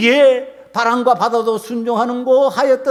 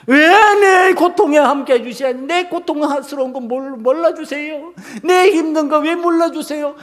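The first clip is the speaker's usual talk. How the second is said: raised, aggrieved pleading; resentful complaining; 5 semitones higher than usual. Acted. Believer protesting to God about suffering, aggrieved complaint. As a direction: protest like someone who feels ignored. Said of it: "맨날 이런 방식으로 믿으면".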